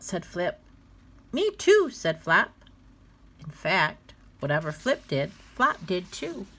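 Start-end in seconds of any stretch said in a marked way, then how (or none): 1.3-1.9 put-on high little-kid me too; eager little brother. Flop copying his big brother, high and eager. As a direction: copycat toddler.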